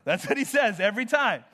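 ordinary talk

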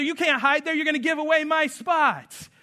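high mock shout between siblings; kids yelling. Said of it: "They might even yell at each other".

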